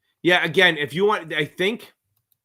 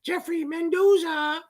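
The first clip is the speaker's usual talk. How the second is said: much higher, slow self-mocking announcer call; slower than usual. Shown as a demonstration. Mocking his own announcer voice, much higher and slow. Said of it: "but probably coming out goes".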